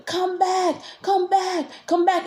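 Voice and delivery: high, slow sing-out call; imitated calling voice. Imitating the voices calling in the ear, high drawn-out call. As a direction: call them back in a high, stretched voice. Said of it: "So she's like".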